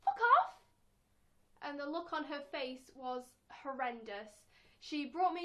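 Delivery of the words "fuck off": high loud cheerful yell; innocent shout. Retold or imitated. Four-year-old shouting a swear at mum. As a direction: proudly blurt it out. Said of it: "I went".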